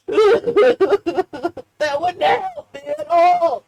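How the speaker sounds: breaking into laughter over the goodbye kiss; laughing. Real, not acted. Laughing at picturing a goodbye kiss to Uncle Ray.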